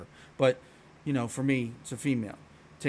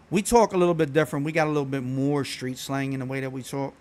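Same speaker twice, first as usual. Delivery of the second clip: usual speaking voice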